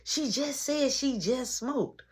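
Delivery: slow disbelieving inner voice; lightly voiced inner disbelief; inner thought. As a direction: think it, slowly stunned. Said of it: "in my mind".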